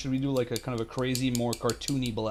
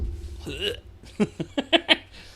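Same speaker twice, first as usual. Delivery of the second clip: laughing; 7 semitones higher than usual. Real, not acted. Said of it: "Sorry, I got carried away here".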